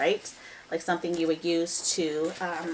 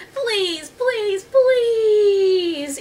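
high, long wailing pleases; shouted plea; 12 semitones higher, 6 dB louder, much slower than usual. A narrator's reading. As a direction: beg at rising volume, stretching the last please. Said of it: "Annie shouted".

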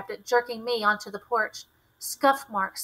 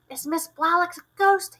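frightened cry; 8 semitones higher, 5 dB louder than usual. A narrator's reading. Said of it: "he cried, his face ashen".

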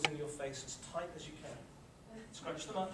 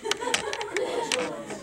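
correcting the class; 10 dB louder, much slower than usual. Real, not acted. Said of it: "No, just our arms".

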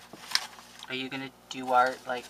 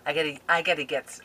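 ordinary talk

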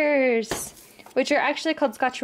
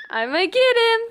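higher, louder teasing shout; playful shout; 9 semitones higher, 4 dB louder than usual. Pretend. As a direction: call it out in the chase. Mom in chase game.

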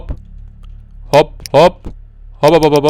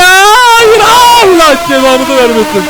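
long, high, loud wail of protest; hammed-up scream, stretched right out; deliberate fake yelling; 7 dB louder, much slower than usual. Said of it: "Bilerek bağırma". (bilerek abartılı çığlık)

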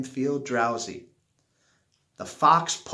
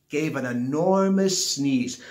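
usual speaking voice

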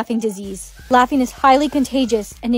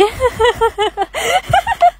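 high, staccato giggling fit; uncontrolled laugh; 11 semitones higher than usual. Acted. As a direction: break into helpless high laughter. Teacher catches the laughing disease.

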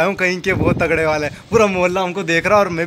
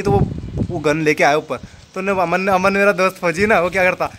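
ordinary delivery

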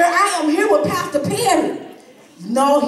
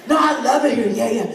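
ordinary talk

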